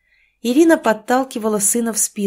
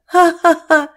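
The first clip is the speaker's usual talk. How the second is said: mocking cackle; 9 semitones higher, 7 dB louder, slower than usual. Neighbour jeering.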